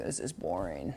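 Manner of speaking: bored dog voice. Retold or imitated. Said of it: "He's like".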